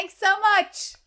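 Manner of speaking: high, loud sing-song gushing thanks; mock chirpy thanks. Mocking her friend's gushing thanks at the shop, high and bright. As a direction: gush like an overly polite customer.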